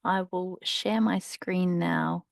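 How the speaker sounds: ordinary talk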